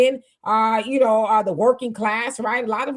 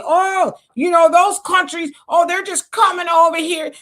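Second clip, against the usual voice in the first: mocking; 6 semitones higher, 5 dB louder than usual. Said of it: "then they come in and say".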